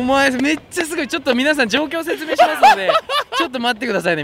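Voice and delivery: talking through laughter; laughing while explaining. Real, not acted. 笑いながら説明. (笑いながら)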